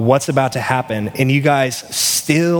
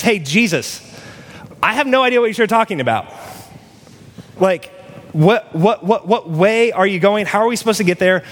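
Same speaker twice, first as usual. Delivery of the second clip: higher, fast, bewildered disciple voice; confused disciple; 6 semitones higher than usual. Retold or imitated. Disciple to Jesus, lost and confused, voice pitched higher. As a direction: play a baffled follower who has lost the plan. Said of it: "everyone kind of cringes like".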